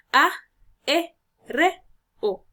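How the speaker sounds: vowels said one by one, pausing between; syllable by syllable. Shown as a demonstration. Teacher showing the students how aéreo divides, syllable by syllable. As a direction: split aéreo into its syllables aloud. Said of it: "se divide de la siguiente manera".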